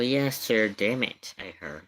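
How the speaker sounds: ordinary talk, nothing marked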